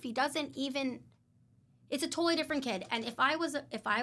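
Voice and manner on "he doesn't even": normal talking voice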